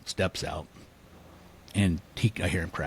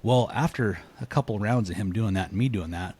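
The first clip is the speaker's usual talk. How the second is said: normal talking voice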